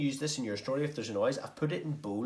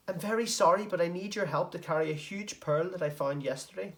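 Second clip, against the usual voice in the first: Carlton Crabbe pleading; 8 semitones higher, 4 dB louder than usual. Acted.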